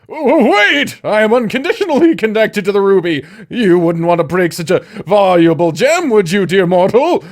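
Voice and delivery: high, loud, fast flustered plea; panicked demon snake. Acted. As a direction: scramble to talk your way out. Snake recoils in horror.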